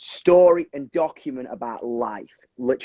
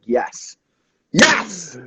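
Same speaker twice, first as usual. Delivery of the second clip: excited yes; 6 semitones higher than usual. Retold or imitated. Eager reply to punk rock plan.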